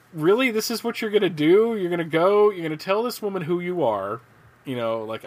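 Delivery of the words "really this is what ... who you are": higher, disbelieving rising questions; incredulous. Real, not acted. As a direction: ask it as if you can't believe it. Incredulous reaction to the comic scene.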